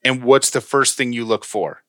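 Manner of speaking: ordinary talk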